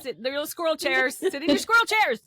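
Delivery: high, fast, sing-song bossy voice; cutesy voice for squirrels. Pretend. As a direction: boss tiny squirrels around in a high voice. Ordering squirrels into tiny chairs.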